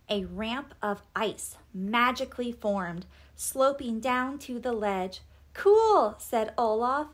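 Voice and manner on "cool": high, loud delighted exclamation; excited. A narrator's reading. Olaf admires the ice ramp, excited. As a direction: let out an impressed cheer.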